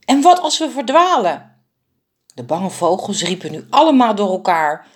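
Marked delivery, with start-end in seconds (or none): 0.0-1.5 high, loud panicked cry; calling out. A narrator's reading. A frightened bird cries out to the flock, high and loud. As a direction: call out in alarm across the tree. Frightened birds call out together.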